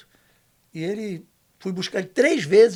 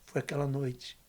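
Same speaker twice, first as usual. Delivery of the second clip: father's soft confession; 6 semitones lower, 10 dB quieter than usual. Retold or imitated. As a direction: quietly voice the father asking forgiveness. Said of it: "ele olhou dentro do meu olho e falou assim". (confissão baixa do pai, em voz contida)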